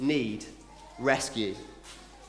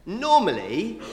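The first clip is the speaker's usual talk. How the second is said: usual speaking voice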